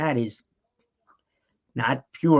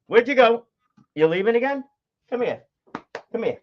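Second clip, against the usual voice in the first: higher, sing-song call to a dog; coaxing the dog; 8 semitones higher, 3 dB louder than usual. Real, not acted. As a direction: coax the dog back to you.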